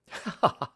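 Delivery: brief amused chuckle; a quick light laugh; short laugh. Acted. Holmes laughs at Watson's question, short chuckle. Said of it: "Sherlock Holmes laughed".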